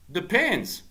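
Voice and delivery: a hint of the bargaining caddy; imitated old caddy. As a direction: lightly voice the cagey caddy. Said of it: "And Twig's like".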